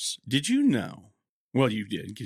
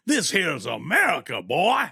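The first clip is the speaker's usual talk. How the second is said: a loud Southern accent, like a cartoon rooster; 4 semitones higher, 4 dB louder than usual. To his cohost, joking about the southern bald eagle in a Southern accent. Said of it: "gave it, like, a southern accent".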